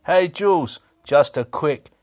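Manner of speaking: ordinary talk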